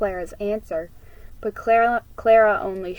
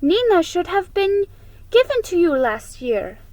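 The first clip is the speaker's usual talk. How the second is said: put-on higher, louder, quick girl's voice; a big sister jumping in eagerly; eager Ana; 9 semitones higher, 6 dB louder than usual. A narrator's reading. Ana's voiced lines, eager.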